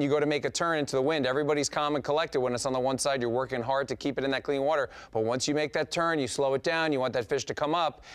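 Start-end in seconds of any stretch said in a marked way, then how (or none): none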